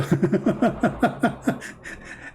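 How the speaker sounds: breathy, helpless giggling; real laughter. Real, not acted. Amused speaker cracks up telling the garbage collector story, real laughter. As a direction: break up laughing mid-story. Breaks up at own joke.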